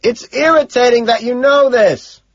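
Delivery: high, loud yell; full-volume holler; shouting. A narrator's reading. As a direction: shout the complaint over the crowd. Shouted exchange at a concert.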